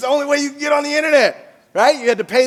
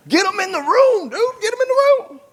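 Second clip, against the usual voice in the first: excited urging; 11 semitones higher than usual. Real, not acted. Urging dealers to recruit.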